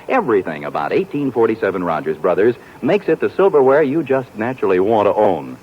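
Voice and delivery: ordinary delivery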